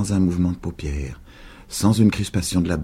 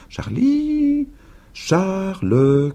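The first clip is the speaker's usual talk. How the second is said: crying out; 14 semitones higher, much slower than usual. A narrator's reading. Old woman calling for hiding boy, high call. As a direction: fling the name out to the hills. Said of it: "criait-elle".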